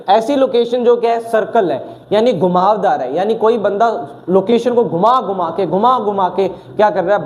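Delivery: ordinary talk, nothing marked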